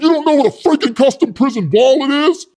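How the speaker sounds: incredulous high, loud outburst; imitating a blustering pitchman yelling; outraged mock shout. Pitchman erupts at the question.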